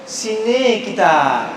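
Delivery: very slow Sarawak Malay, sini stressed and falling; Sarawak dialect. Shown as a demonstration. Lecturer showing the audience Sarawak speech, slow Sarawak accent. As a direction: drawl it like a Sarawakian. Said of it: "dengar orang Sarawak pula".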